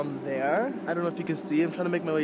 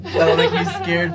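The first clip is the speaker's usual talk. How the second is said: laughing; 7 dB louder, slower than usual. Real, not acted. Friend says long way down.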